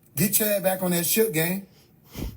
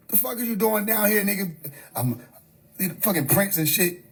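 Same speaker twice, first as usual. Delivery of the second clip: ordinary talk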